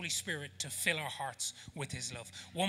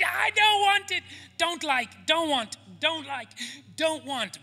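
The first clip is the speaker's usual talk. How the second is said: put-on high, loud childish whine; shrill mock tantrum in short bursts; refusing toddler; 12 semitones higher, 8 dB louder than usual. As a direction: imitate a little girl digging her heels in. Imitating his refusing toddler.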